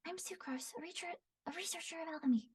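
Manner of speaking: high, soft, fast and airy; Sucrose's voice. Shown as a demonstration. Voice actor demoing a nervous alchemist girl, high and quick. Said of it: "very quick, almost nervous".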